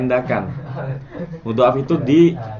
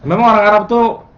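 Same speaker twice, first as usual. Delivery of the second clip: higher, louder burst of amazement; excited marvel; 9 semitones higher, 7 dB louder than usual. Real, not acted. Teacher marvelling to students about Arabs, excited and loud. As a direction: gush about it with real wonder.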